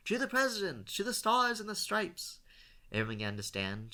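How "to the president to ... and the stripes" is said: yelled toast. A narrator's reading. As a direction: raise a glass and yell it to the whole bar. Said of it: "began to yell".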